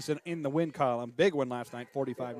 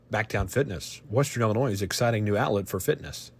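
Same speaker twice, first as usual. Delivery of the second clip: lower, louder polished commercial read; sponsor ad read; 5 semitones lower, 6 dB louder than usual. Acted. Announcer reading sponsor messages to radio listeners, ad read. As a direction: sell it like a radio spot.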